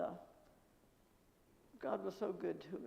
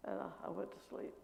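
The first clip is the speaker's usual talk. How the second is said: ordinary talk, nothing marked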